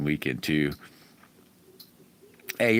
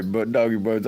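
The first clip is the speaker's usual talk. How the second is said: usual speaking voice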